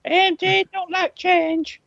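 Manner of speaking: usual speaking voice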